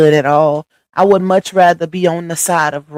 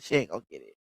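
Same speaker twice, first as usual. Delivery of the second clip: quiet; 14 semitones lower, 14 dB quieter, much faster than usual. Shown as a demonstration. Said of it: "Nah, she ain't gonna get it".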